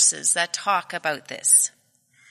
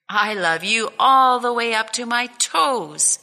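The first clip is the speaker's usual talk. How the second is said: higher and louder, excited little bunny; little hare upside down; 5 semitones higher, 7 dB louder than usual. Acted. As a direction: shout it up from upside down like a thrilled small child. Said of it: "he said".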